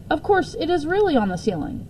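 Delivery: plain reading voice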